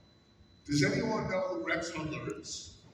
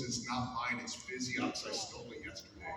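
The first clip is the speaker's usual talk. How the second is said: lightly voiced hoarse impression, dropping quieter; Rex Hudler; 3 semitones lower, 5 dB quieter than usual. Former big leaguer confesses to stealing a ring, hoarse imitation. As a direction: imitate him with the voice fraying into rasp. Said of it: "he talks like this".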